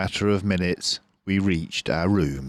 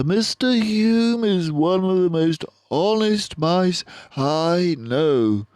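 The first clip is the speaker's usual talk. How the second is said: higher, slow, braced voice; a worried father mouse, held together; strained father; 11 semitones higher, 5 dB louder, slower than usual. A narrator's reading. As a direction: hold yourself together to explain. Father answers.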